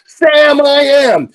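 loud, high chorus-style shout; kids shouting out. Retold or imitated. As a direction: bellow it like kindergartners joining in. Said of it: "the kids shouted out".

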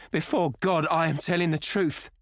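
high, loud pleading oath; crying out high. A narrator's reading. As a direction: swear it desperately to heaven. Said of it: "she cried".

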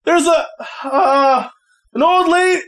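put-on high, hesitant teen voice; a lightly voiced flustered kid; dazed teen. Teen unsure how to announce the visitor, high and halting. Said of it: "just goes".

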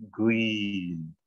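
GREEN with a long drawn-out ee; long vowel. Shown as a demonstration. Coach saying the word for the colour, long vowel. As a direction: stretch the ee in GREEN. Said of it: "Jadi kamu bilang hijau".

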